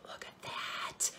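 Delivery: slow awed whisper; voiceless hushed look. Real, not acted. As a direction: breathe it out in wonder. Hushed showing off piece.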